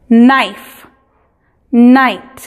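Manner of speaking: "knife" and "night" with no k sound at the start; English model words; silent k. Shown as a demonstration. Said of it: "la K en la combinación K N es muda".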